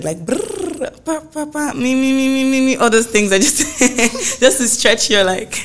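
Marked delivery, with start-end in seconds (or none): 0.2-3.8 high lip trill and sung syllables; vocal warm-up. Shown as a demonstration. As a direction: run through singer's warm-up sounds. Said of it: "So it's, like, just, like, um, I'm sure you heard it".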